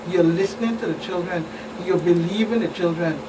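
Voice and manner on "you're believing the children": ordinary talk, nothing marked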